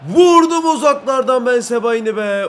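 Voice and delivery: high, loud cry over the shot; exasperated yell. Real, not acted. Player shoots from far out, exasperated yell. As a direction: yell it out in frustration. Shot missed during live play.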